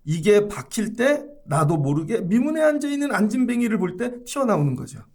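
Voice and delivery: ordinary delivery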